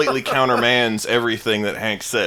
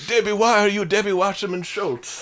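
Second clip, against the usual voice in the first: put-on high, accented old lady; scolding grandma; 7 semitones higher than usual. Voicing the grandmother.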